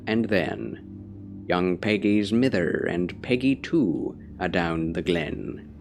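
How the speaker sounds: put-on Scotch-Irish accent, measured poetry recital; Scottish accent. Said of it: "slide into the accent".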